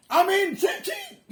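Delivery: high, shouted call after someone; a sharp, high summons across the road; urgent call. Retold or imitated. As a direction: yell to make him turn back. Calling Amin back urgently.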